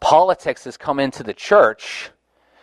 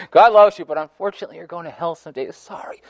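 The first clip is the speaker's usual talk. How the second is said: mock sweetness; 7 semitones higher than usual. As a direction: mockingly break bad news, fading to a tiny sorry. Mocking the same message, hammed up.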